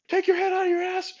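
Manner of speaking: high, fast, put-on voice through laughter; squeaky caricature ranting; mock angry caller. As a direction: lampoon an officer yelling on the net. Mocking a furious radio caller.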